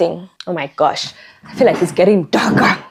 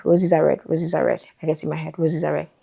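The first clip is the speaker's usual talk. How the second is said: soft falling retake; 6 dB quieter than usual. Shown as a demonstration. Recording retakes, a soft falling take. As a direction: trail off, muttering the retake. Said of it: "I say it so many times".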